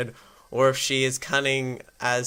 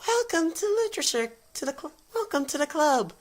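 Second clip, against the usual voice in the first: very high falsetto welcome; put-on squeaky girl voice; strained girl voice; 3 dB quieter, faster than usual. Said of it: "trying to do that girl's voice".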